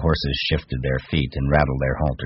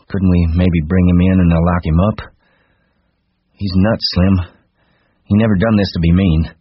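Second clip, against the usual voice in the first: pleading; 3 semitones higher, 6 dB louder than usual. A narrator's reading. George asks Slim to spare Lenny, pleading. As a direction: plead his case earnestly.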